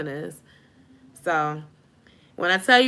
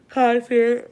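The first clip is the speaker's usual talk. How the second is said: talking through a real yawn; higher, louder word stretched by a yawn; 5 semitones higher, 6 dB louder than usual. Real, not acted. Sleepy host on her livestream, yawning. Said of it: "I'm just yawned all in y'all face".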